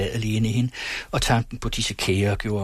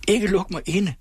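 much higher, begging in fear; fearful pleading cry; 9 semitones higher than usual. Acted. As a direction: plead desperately not to be locked in. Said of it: "råbte fru Syverts angstfuldt bedende".

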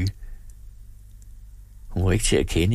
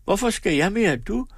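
put-on higher girl's voice, pushing back; defiant girl; 10 semitones higher than usual. A narrator's reading. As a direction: throw the question back at mother. Daughter protests back at mother.

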